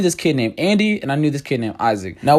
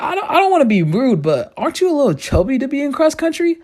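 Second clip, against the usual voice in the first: put-on girl's voice; 10 semitones higher than usual. Girl voiced in story.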